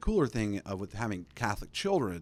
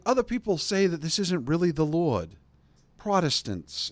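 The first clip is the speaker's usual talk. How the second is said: louder, higher preaching; fiery sermon; 6 semitones higher, 6 dB louder than usual. Retold or imitated. Priest attacking doubters in his homily, revved-up preaching. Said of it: "it starts, like, ripping".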